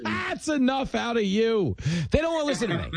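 high-pitched comic protest; mock scolding. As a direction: playfully shut down the teasing in a high voice. Host mock-scolds the teasing trainer.

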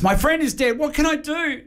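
frantic plea down the phone; gasping panic. Acted. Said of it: "He gasps".

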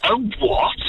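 mock incredulous question; baffled reaction. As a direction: act out being taken aback. Retold baffled reply to wife.

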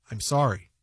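very low, smooth brush-off; imitated practiced apology. Host shows how the apology was said, deadpan. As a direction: drop deep to copy the rehearsed apology. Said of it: "The way you said it was very".

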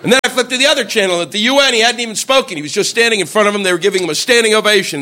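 normal talking voice

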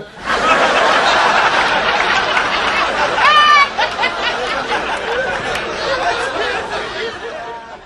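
breathy wheezing laugh. Real, not acted. Comic laughs at own joke.

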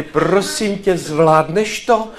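put-on slow, careful question to a drunk colleague; lightly acted, drawn-out plea; starostlivá prosba. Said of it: "za ním přišli a říkali". (pomalá, naléhavá otázka)

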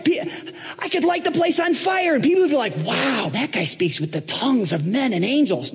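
very high, excited boasting; excited Trump. Acted. Boastful applicant pitching himself to Paul, excited. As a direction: Trump bragging to Paul about seminary, high and thrilled. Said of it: "then Donald gets all excited".

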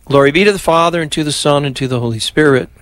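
devout Glory Be; prayer recitation. Acted. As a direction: lead the doxology evenly. Leads the Glory Be.

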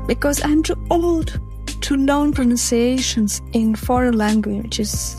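usual speaking voice

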